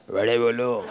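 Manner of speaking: low, menacing hawker voice; deep, bullying platform vendor; threatening vada seller. Retold or imitated. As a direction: sell vadas like you're making a threat. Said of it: "जैसे मुझे धमकी दे रहा हो".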